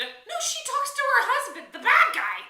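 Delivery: outraged horror fan; exasperated outburst. Real, not acted. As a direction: burst out at the heroine's mistake. Exasperated at the movie heroine.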